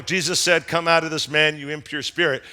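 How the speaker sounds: low monotone, softening at the end; monotone reading. Shown as a demonstration. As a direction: read it out with no feeling. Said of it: "We read it in this monotone voice".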